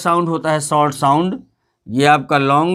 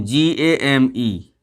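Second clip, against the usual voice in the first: slow spelling; 4 semitones lower, much slower than usual. Shown as a demonstration. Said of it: "spelling out slowly".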